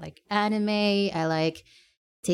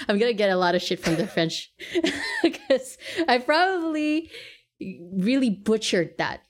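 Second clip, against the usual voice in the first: laughing; 5 semitones higher, 3 dB louder than usual. Real, not acted.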